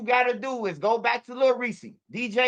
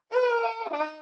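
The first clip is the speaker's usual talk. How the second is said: high, weepy sigh done for laughs; mock crying sigh. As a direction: sigh like a heartbroken man. Retelling friend crying over girl.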